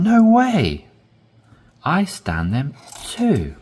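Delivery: No way said high and loud in surprise; surprised emphasis. Shown as a demonstration. Teacher demonstrating surprise for learners, high and loud. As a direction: burst out No way, amazed. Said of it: "Your inflection".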